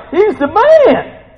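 high, drawn-out, excited cheer; acting out awed angels. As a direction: holler it like an adoring fan. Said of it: "And they're like".

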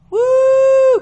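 high, loud "woo"; excited whoop. Real, not acted. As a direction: whoop with joy. Preacher whoops over the scripture.

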